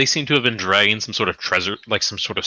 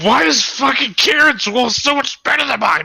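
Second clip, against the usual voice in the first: very high put-on griping; exaggerated squawk of jealousy; mock envious complaint; 4 dB louder than usual. Everyone envying the better wolf, high mock griping. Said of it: "Everyone's like".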